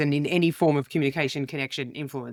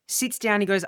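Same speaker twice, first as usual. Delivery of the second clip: usual speaking voice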